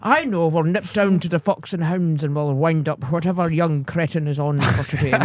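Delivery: mock-posh villagers planning their fun; posh pensioners. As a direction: put on a posh, scheming customer voice. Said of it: "they were so fucking posh".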